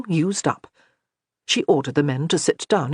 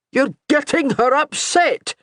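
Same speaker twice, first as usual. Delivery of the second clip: high, slow shouted rebuke; old soldier scolding a young man, shouting; shouting warning; 9 semitones higher, 3 dB louder than usual. A narrator's reading. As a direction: yell it, drawn out, at the boy. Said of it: "Oggy shouted".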